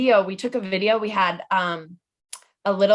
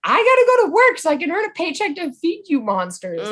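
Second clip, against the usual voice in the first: higher, louder scolding of the pets; mock yelling at cats; 9 semitones higher, 5 dB louder than usual. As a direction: playfully yell at the cat monsters. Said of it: "I yell at them all the time".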